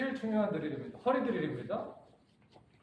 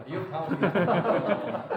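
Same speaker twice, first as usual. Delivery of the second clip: slow, louder, laughing repeat of the warning; laughing tease; 7 dB louder, slower than usual. Real, not acted. Teacher teasing the nervous volunteer, laughing through the words. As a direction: chuckle it back at him as a playful dare.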